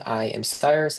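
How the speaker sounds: ordinary talk, nothing marked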